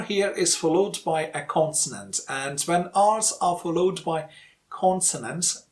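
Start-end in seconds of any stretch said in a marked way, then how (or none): none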